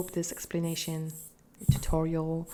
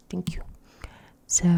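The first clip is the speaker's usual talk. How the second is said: whispering. Acted. Whispered thanks.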